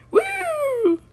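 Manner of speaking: long, high "woo"; drawn-out gleeful squeal; high whoop. Acted. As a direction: whoop as you spin. Spins around.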